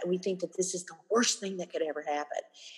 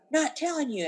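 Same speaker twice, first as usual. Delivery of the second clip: mock defiant refusal, pitched up; defiant kid; 7 semitones higher than usual. Upset pupil to visiting adult, mock defiant. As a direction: refuse like a stubborn child.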